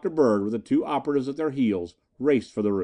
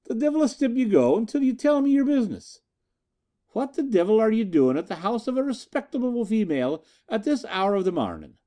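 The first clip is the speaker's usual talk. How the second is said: indignant Irishwoman; 10 semitones higher than usual. A narrator's reading. Respectable woman scolding the police intruders, indignant and high. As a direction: scold them like an offended landlady. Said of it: "demanded the fiery-haired Amazon".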